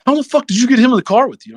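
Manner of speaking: fast, high-pitched incredulous question; retold disbelief. Team guy quizzing the rookie about his co-driver, astounded. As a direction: blurt it out in mock astonishment.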